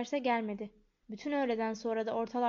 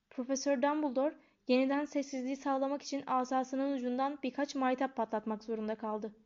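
plain reading voice

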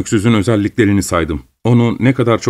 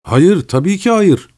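higher, slow protest bursting out; blurting out; 8 semitones higher, slower than usual. Acted. Son cutting in on his mother, a hurried high denial. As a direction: jump in to deny it, much higher. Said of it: "diye atıldım".

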